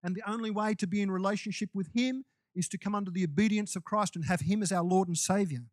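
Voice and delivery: normal talking voice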